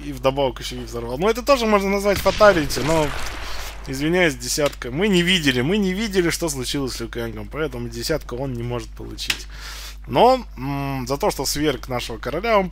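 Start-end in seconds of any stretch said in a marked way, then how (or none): none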